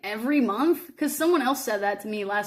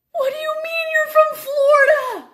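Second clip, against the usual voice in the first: much higher, loud laughing shout; shocked disbelief; 8 dB louder, slower than usual. Retold or imitated. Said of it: "I was like".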